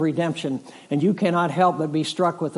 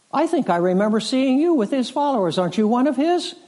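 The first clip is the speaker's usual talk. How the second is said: much higher-pitched pointed questioning; ladies' accusation; 9 semitones higher, 4 dB louder, faster than usual. Retold or imitated. Ladies there press Peter about Jesus, accusing. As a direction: voice an onlooker pressing him, pitched well up.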